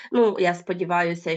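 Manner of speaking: usual speaking voice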